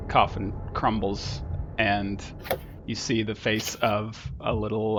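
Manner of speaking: normal talking voice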